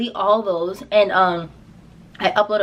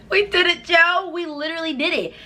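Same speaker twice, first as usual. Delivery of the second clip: excited; 7 semitones higher than usual. Retold or imitated. Celebrating going viral.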